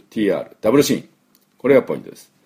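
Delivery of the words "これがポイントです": ordinary delivery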